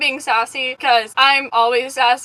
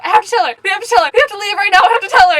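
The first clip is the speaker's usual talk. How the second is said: higher, louder, insistent pleading; mock panic; 6 semitones higher, 6 dB louder, faster than usual. Friend urgently wants to confess the prank, imitated panic.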